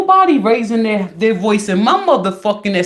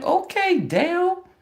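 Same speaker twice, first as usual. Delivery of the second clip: mock sweet; 9 semitones higher, 5 dB quieter than usual. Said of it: "I'm like".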